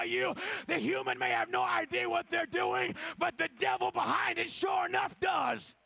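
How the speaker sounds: very high, loud shouted preaching; preaching at full force. Acted. Preacher warning the church off Delilah spirits, shouting. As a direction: whip the church up, shouting each line.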